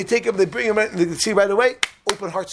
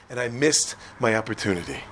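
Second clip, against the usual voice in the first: low, softer, falling away; sombre regret; 8 semitones lower, 5 dB quieter than usual. Acted. Friend admitting his lost chance, sombre regret. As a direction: let it sink in regretfully.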